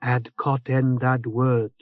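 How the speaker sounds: slow drowsy mumble; half asleep, slurring the words; sleepy. Acted. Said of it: "Tick commented sleepily".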